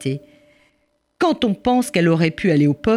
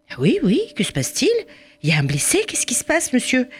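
higher, worried questioning; a hint of a worried mother; alarmed mother; 8 semitones higher than usual. Mother opening the door to police, worried high voice.

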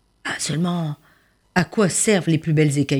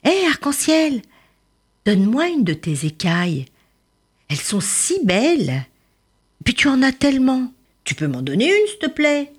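lightly voiced little fish, high pleas; a hint of a pleading little fish; timid small fish asking; 8 semitones higher than usual. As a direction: ask sweetly like a small fish. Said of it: "il osa lui dire".

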